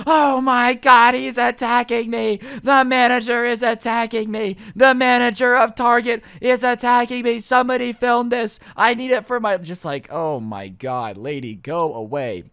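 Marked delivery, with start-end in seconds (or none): 0.1-9.6 high, loud, stretched-out wailing cry; mock screaming. Customer screams as manager guides her out, loud theatrical hysteria. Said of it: "And she starts screaming". 10.1-12.4 low, slow, weary plea; exasperated. Retold or imitated. Onlooker fed up with the screaming customer, low exasperation.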